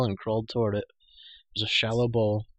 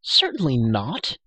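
slow, louder emphatic denial; indignant cry; 3 semitones higher, 6 dB louder, slower than usual. A narrator's reading. Girl offended by the prisoner's question, indignant cry. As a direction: deny it indignantly, drawing out each word. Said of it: "cried the girl".

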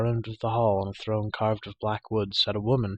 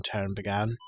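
usual speaking voice

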